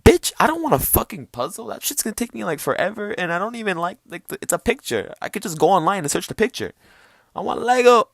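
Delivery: put-on high-pitched bratty kid rant; indignant kid whining at the parents, high-voiced. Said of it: "I'm like".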